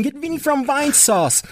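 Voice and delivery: squeaky high whining plea; mock the fans in a high whine; whiny fan voice. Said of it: "I get snaps saying".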